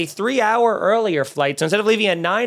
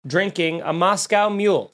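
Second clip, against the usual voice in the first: normal talking voice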